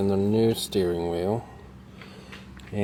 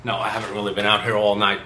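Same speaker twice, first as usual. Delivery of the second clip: ordinary talk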